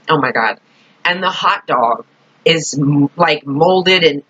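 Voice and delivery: ordinary talk, nothing marked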